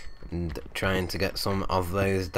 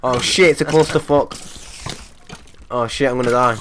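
loud, higher startled outburst; panicked alarm; 4 semitones higher, 7 dB louder than usual. Real, not acted. Gamer caught by a cave mob swarm, loud panic. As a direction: yelp as mobs swarm in.

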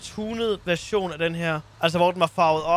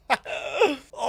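laughing; 6 semitones higher than usual. Real, not acted. Jokes about the borrowed bus.

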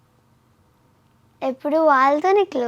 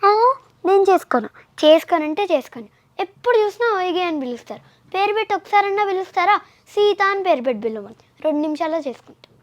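fast, higher-pitched indignant refusal; acted film dialogue; 4 semitones higher, faster than usual. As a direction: rattle off a huffy movie-heroine tirade. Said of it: "నీకు వచ్చిన dialogue ఏదని చెప్పు".

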